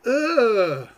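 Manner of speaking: high loud bickering characters; squeaky toy voices squabble; puppet voices arguing. Acted.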